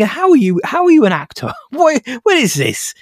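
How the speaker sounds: high-pitched disbelieving questions; incredulous. Acted. Film critic questioning how Statham became an actor, incredulous.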